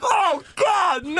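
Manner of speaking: high shouted panic; realizing he is about to be blamed; dread shout. Retold or imitated. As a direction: cry out in sudden dread. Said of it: "I'm like".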